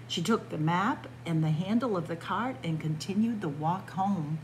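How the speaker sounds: usual speaking voice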